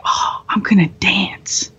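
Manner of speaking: slow breathy whisper; a slow, drugged, dreamy whisper. Retold or imitated. Drugged patient to the nurses, slow whisper. Hopped up on painkillers.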